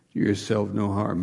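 ordinary talk

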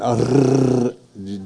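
long trilled rolled R like a motorboat; rolled R drill. Shown as a demonstration. Pastor showing his Finland tongue practice, motorboat trill. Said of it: "do that like a motorboat".

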